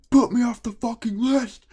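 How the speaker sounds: high, loud demanding yell; mock her screeching demand; shrill angry woman. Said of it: "and she's like".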